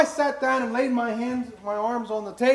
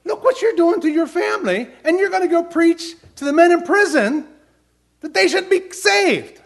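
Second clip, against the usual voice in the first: high, loud rebuking voice; voice of the Lord; 10 semitones higher, 6 dB louder than usual. Retold or imitated. The Lord rebuking the preacher on his drive, high loud scolding. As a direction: voice the Lord scolding him, rising. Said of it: "the Lord spoke to me and said".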